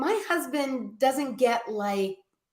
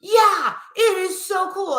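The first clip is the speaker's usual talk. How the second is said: higher and louder, gushing with delight; giddy excitement; 9 semitones higher, 4 dB louder than usual. Retold or imitated. Husband answering his wife, giddy about the Hummer. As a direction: gush like a thrilled kid.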